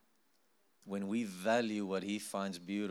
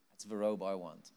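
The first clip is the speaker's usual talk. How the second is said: normal talking voice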